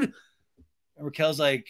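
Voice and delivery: usual speaking voice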